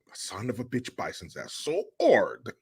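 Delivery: mock French-accented tough guy; French accent, leaning hard on the last word. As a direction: mimic an action hero's French accent. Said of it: "you gotta ditch that French accent".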